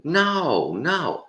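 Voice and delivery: high, swooping horrified no; mock refusal. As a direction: imitate someone recoiling at the idea. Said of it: "And they're like".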